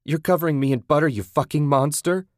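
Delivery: fast, high, loud outburst; outraged protest. Acted. Captive Susan to her captor, outraged. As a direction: hurl it at the captor in disbelief.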